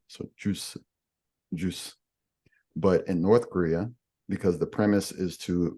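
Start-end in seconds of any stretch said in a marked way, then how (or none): 0.4-0.8 "juice" softly in a Korean accent. Shown as a demonstration. Teacher showing the South Korean loan word, Korean accent on "juice". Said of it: "they'll say it with a Korean accent". 1.5-1.9 "juice" softly in a Korean accent. Shown as a demonstration. Teacher showing the South Korean loan word, Korean accent on "juice". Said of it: "they'll say it with a Korean accent".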